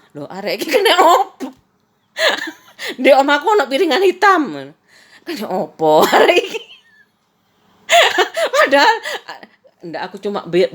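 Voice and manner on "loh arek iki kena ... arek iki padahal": high, laughing disbelief; retelling a friend's odd reply, laughing. Retold or imitated. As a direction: giggle through the words in disbelief.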